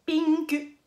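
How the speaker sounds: pinku in two drawn-out beats with a full final "ku"; U final prononcé. Shown as a demonstration. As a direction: add a clear U to the end: pinku. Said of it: "Donc, on dit pinku". (pinku à la japonaise, en appuyant le « kou » final)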